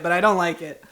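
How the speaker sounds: ordinary delivery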